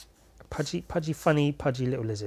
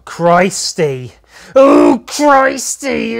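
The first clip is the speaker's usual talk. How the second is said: exasperated; 8 semitones higher, 12 dB louder, much slower than usual. Real, not acted. Scolding herself after mistake.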